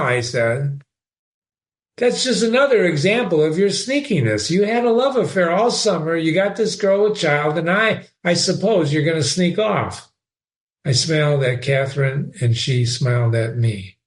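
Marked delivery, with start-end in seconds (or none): 2.0-10.1 higher, lightly voiced prim nurse; a hint of a righteous friend; Ferguson, scolding. A narrator's reading. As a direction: lecture him on his sneakiness.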